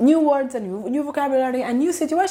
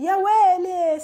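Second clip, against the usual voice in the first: high, dramatized exclamation of shock; 8 semitones higher, slower than usual. Shown as a demonstration. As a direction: cry it out high, as if something happened.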